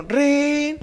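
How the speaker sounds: high, held ringing noise; mimicked old telephone; imitated phone ring. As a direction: trill a telephone ring by mouth. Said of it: "Sonó el teléfono".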